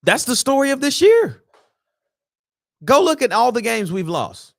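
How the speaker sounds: exasperated. Real, not acted. Exasperated rant about season.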